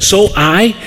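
ordinary talk

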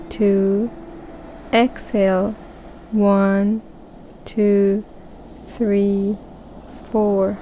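unhurried counting with pauses; slow breath counting. Shown as a demonstration. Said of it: "We will start breathing to a count".

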